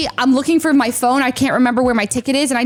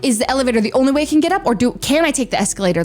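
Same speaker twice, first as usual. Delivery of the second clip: fast, flustered questions; rushed panicked thinking; faster than usual. Retold or imitated. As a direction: rattle off in confusion. Panicked rushed inner thought.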